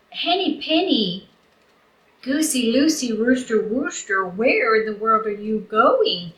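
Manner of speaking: turkey voice. Acted. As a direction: call out to each friend as a turkey. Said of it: "He said".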